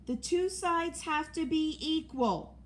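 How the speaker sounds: shouting. A narrator's reading. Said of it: "one of them shouted".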